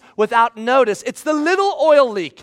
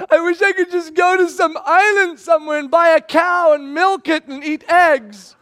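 higher, dreamy longing; wistful daydream; 7 semitones higher, 5 dB louder than usual. Retold or imitated. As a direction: dream out loud of escape. Dreamy escape fantasy.

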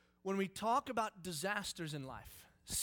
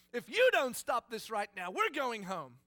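stern threat; 6 semitones higher, 11 dB louder, slower than usual. Retold or imitated. Dad threatens daughter in car.

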